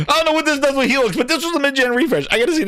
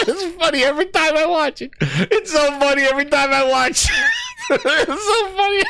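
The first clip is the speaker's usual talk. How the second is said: talking through high, squeaky laughter; helpless laughter; 3 semitones higher than usual. Real, not acted. Streamer rewatching a favourite funny clip, cracking up. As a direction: barely get it out for laughing.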